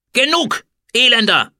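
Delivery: very high, loud imperious command; angry interruption. A narrator's reading. Pharaoh silences the insolent food taster, furious command. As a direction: cut him off with full force. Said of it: "unterbrach Hatschepsut ihn".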